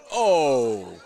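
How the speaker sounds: loud sudden "Oh!"; startled outburst; surprised shout. Real, not acted. Announcer reacts to foul ball.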